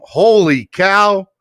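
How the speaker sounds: drawn-out, amazed holy cow; loud, slow gasp of surprise; surprised. Real, not acted. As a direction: let the shock stretch the words out. Huge donation just arrived.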